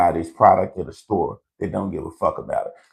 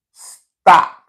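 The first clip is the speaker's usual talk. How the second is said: loud, higher stop; emphatic command; 8 semitones higher, 9 dB louder than usual. Real, not acted. Warning the viewers, loud stop. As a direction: bark the warning hard. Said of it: "I repeat".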